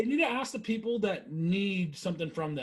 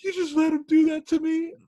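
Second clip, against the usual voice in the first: put-on hurt drunk whine; 8 semitones higher, 5 dB louder than usual. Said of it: "he goes".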